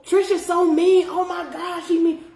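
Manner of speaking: high-pitched mock whining; put-on high, scandalized gossip voice; mocking complainers. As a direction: imitate people griping about how mean she is. Mimics people complaining about her.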